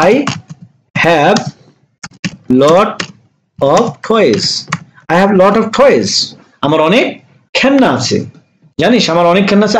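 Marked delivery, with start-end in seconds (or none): 0.1-4.4 each word slowly, with long pauses between; teacher dictates one word at a time for students. Shown as a demonstration. Teacher to students, dictating slowly word by word. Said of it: "teacher spells out English sentence".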